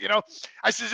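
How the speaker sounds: ordinary talk, nothing marked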